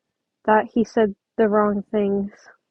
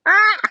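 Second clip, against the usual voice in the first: startled yelp; 11 semitones higher, 7 dB louder than usual. Real, not acted. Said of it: "Oh my God".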